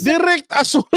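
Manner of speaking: incredulous. Real, not acted. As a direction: repeat it like you can't believe it. Incredulous repeat of charge.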